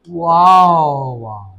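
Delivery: very slow, savouring wow; drawn-out wow. Real, not acted. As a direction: stretch the wow out in delight. Reacting to the aroma. (ਹੌਲੀ ਲੰਮਾ ਵਾਓ)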